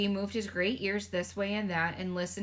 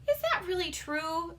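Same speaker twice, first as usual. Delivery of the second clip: Sophie voice; 9 semitones higher than usual. Acted. Sophie answering the giant, small and hushed.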